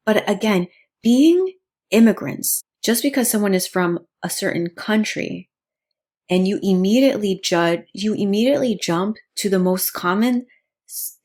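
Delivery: ordinary delivery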